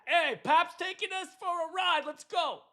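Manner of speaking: put-on high-pitched Jersey-accented grandpa on a boat. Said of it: "I got a Jersey accent".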